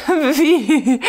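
a laughing high Wie; cheerful laughing exclamation. Acted. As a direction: burst out amused, laughing through it. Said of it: "rief Koltow immer heiterer".

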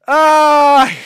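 short, loud, high yelp; pained yelp. Real, not acted. Streamer yelping at a play on screen, sharp pained yelp. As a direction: let out a sharp cry of pain at the play. Gamer yelps at the game.

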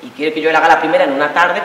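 normal talking voice

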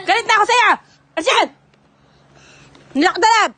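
loud hammed-up mock scolding; pretend angry shout. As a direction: shout it like a fake angry parent. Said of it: "Kunwari galit tayo kay Tos". (kunwaring galit na sigaw)

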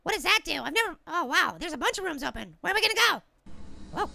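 ordinary talk, nothing marked

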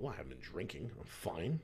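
low, hushed talking to yourself; soft, retold inner pep talk; quiet self-reassurance. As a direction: reassure yourself under your breath. Rehearsing to himself at roadblock.

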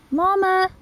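high, loud call across the field. Real, not acted. Calling out to the grieving cow, loud and high. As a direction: call the cow by name from a distance.

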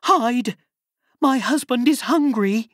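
high, loud panicked whisper-shout; urgent giantess. A narrator's reading. Giantess warning Jack about her hungry husband, high and panicked. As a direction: warn him to hide, frightened.

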